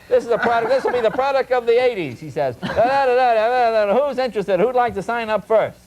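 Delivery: high, loud hammed-up sales pitch; eager salesman pitch. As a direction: sell it hard to a bored room.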